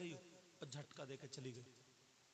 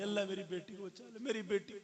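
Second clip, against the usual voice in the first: higher, louder pleading prayer; a mother begging God to save her daughter, higher and louder; mother's desperate prayer; 6 semitones higher, 8 dB louder than usual. Retold or imitated. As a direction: beg God for her daughter, rising loud.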